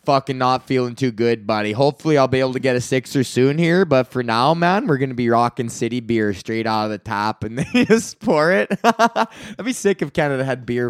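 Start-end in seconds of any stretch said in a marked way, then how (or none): 0.1-8.7 deep, drawled hoser buddy voice; low dude voice. Retold or imitated. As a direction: play a down-on-his-luck beer buddy. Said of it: "It's like".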